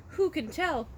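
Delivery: ordinary delivery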